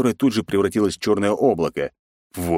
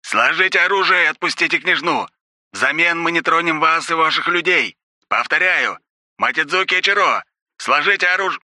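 high, loud amplified ultimatum; air raid loudspeaker; 11 semitones higher, 3 dB louder than usual. A narrator's reading. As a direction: announce it through a loudspeaker. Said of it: "раздалось из громкоговорителя".

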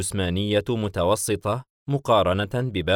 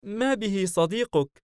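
put-on very high, slow puzzled child voice; little boy wondering aloud, pitched well up; 11 semitones higher than usual. Child Leviathan asks about the friend, puzzled high voice. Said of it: "باستغراب".

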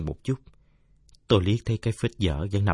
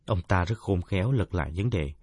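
ordinary delivery, nothing marked

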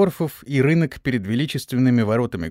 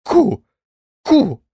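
high, loud cornered squawk; yelling; 10 semitones higher, 6 dB louder than usual. A narrator's reading. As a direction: crow it out from the corner. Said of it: "забился в угол и орал". (орёт высоким голосом)

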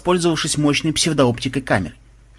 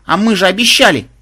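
high, loud aggrieved complaint; hurt protest; 6 semitones higher, 6 dB louder than usual. A narrator's reading. Granddaughter reminds them of the promise, offended. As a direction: insist on the broken promise. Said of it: "обиженно заметила внучка".

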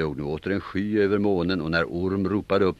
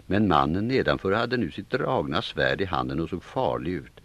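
plain reading voice

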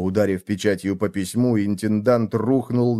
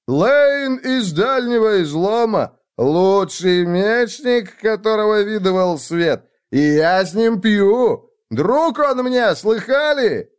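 high, slow, drawn-out bellowed boast; drunken bragging shout; 10 semitones higher, 4 dB louder, slower than usual. Acted. Drunk friend boasts to the tavern, shouting proudly. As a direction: proclaim it proudly to the whole room.

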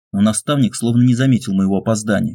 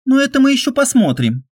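much higher, defiant female voice; defiant young woman. A narrator's reading. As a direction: challenge him, playful defiance. Olga's defiant reply.

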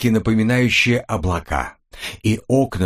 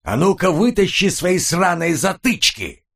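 bellowed order, pitched up; yelling; 8 semitones higher than usual. Acted. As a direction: yell it right in his ear, higher. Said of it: "проорал ему прямо в ухо".

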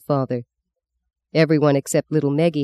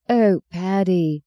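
slow, drawn-out sigh on the name; 4 semitones higher, much slower than usual. Acted. Wife sighing to her husband over the bad news, slow and weary. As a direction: let the name sink out on a breath. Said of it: "Fee sighed".

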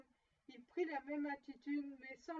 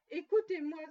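loud, urgent call to listen; a ghost crying out so he is heard; ghost's cry; 3 semitones higher, 12 dB louder than usual. A narrator's reading. Ghost demanding Scrooge listen, loud urgent cry. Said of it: "s'écria le fantôme".

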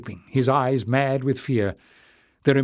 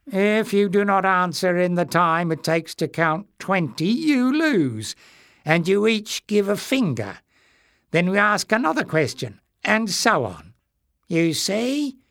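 sly creature; 7 semitones higher than usual. A narrator's reading. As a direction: toy with the captive king. Greyness explains rules.

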